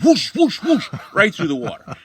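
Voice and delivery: loud, high whooshing sound effect; splashing whoosh. Retold or imitated. Teller mimicking Fred wading through floodwater, loud whooshing.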